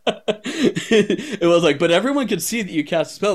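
high, laughing through the words; can barely talk for laughing. Real, not acted. Laughing while retelling the kill.

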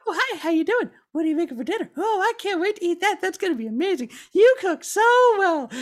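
very high, lovestruck sing-song voice; swooning witch. Retold or imitated. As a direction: gush over him like a smitten witch. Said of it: "the witch is just like".

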